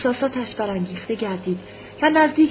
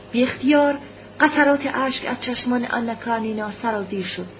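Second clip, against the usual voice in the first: plain reading voice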